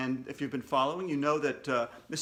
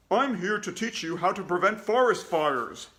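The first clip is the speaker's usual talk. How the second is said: bear voice; 3 semitones higher, 4 dB louder than usual. Acted. Woodsy the Bear telling the class why he came, character voice. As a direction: announce your mission proudly. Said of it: "said Woodsy the Bear".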